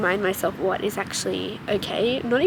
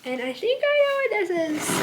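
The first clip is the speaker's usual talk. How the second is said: pretend-unsure guess, voice pitched higher; coy guess; 10 semitones higher than usual. Shown as a demonstration. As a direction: playfully pretend you don't know what's in the package. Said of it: "And by I think I know what this is".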